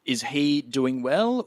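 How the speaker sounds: ordinary talk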